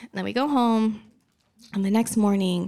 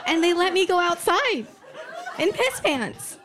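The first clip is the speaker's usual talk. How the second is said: incredulous punchline; 10 semitones higher than usual. Real, not acted. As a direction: cry out the punchline in disbelief. Outraged punchline amid audience laughter.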